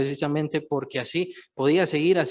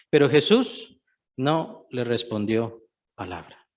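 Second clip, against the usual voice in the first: ordinary talk, nothing marked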